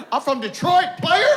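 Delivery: high, loud hyped-up boast; boastful shout. Retold or imitated. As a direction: yell it proudly. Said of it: "I'm like".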